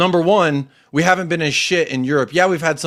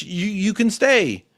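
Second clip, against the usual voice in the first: ordinary talk, nothing marked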